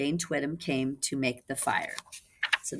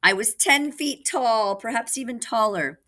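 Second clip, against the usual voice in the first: funny tooth voice; 7 semitones higher, 4 dB louder than usual. Acted. Wolf boasting to the puppets in a funny tooth voice. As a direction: boast through big teeth. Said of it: "in her funny tooth voice".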